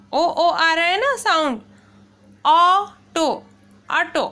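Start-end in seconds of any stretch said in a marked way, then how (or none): 2.5-4.2 ऑटो with an open aw, first take louder; model word auto. Shown as a demonstration. Teacher models ऑटो for pupils, open aw. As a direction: say ऑटो, aw then a clear oh.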